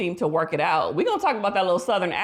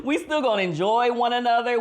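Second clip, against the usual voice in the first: higher voice breaking into laughter; amused reassurance; 4 semitones higher than usual. Retold or imitated. Wife unbothered by her husband's pill, laughing reassurance. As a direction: laugh through the words, playfully reassuring. Playing the wife shrugging off pills.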